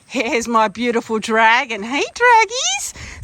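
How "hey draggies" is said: high, slow sing-song hello; drawn-out high coo to a horse; pet greeting. Real, not acted. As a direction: stretch it out high like talking to a pet. Greeting her horse Dragon.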